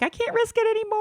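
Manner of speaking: lifted high, squeaky quote. Retold or imitated. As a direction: squeak it out like a cartoon. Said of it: "I'm like".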